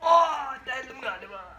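high, slow startled cry. Real, not acted. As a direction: gasp at a sudden sting. Thinks he pierced scalp.

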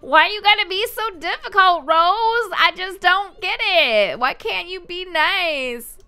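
high, exasperated mock scolding; voicing a frustrated sim. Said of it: "She's like".

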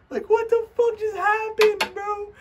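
real falsetto squeal of shock; squeaky disbelief. Real, not acted. Disbelief after the big pull.

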